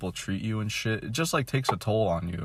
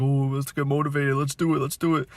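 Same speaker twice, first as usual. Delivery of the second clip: higher, pumped-up mock motivation; mock self pep talk; 6 semitones higher than usual. Vlogger mocks his own pep talk, mock hype. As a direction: hype yourself up, half-joking.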